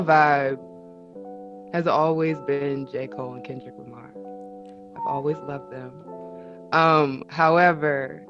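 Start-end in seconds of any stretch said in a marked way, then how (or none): none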